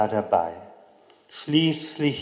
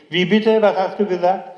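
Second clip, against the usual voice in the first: put-on high, loud call up from the bed, as a child; child calling out high and loud to his mother at night; 7 semitones higher, 6 dB louder than usual. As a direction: call out to make her stop talking. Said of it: "rief ich".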